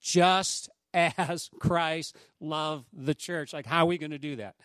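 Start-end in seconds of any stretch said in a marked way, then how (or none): 0.0-3.5 each word weighed, slow and heavy; slow, deliberate correction of a friend; insistent emphasis. Retold or imitated. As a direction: insist on JUST AS, stretched out word by word. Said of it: "he goes, No, Dave".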